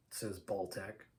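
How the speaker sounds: plain reading voice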